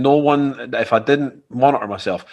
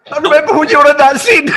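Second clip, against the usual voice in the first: much higher and louder, laughing fan gush; starstruck fan; 9 dB louder, faster than usual. Retold or imitated. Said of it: "and I'm like".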